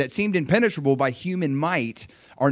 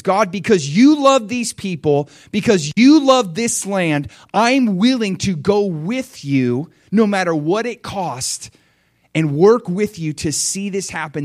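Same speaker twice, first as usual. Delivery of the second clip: higher, louder fervent declaration to God; impassioned pledge; 5 semitones higher, 5 dB louder than usual. Acted. Preacher voicing God's devoted army pledging themselves, impassioned. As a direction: pledge it with full devotion, pitch lifted.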